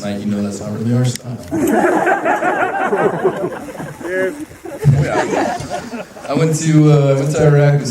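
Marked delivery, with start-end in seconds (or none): 1.4-6.2 high laugh. Real, not acted. Laugh during own toast.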